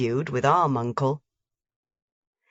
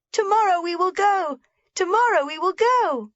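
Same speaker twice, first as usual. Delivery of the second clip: joyful shout; 14 semitones higher than usual. A narrator's reading. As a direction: cry it out in delight.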